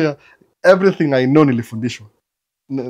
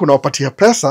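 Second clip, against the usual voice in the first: ordinary talk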